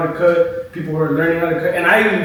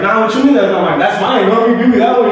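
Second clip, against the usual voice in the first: indignant protest; 5 semitones higher, 4 dB louder than usual. Retold or imitated. Arguing with the lady.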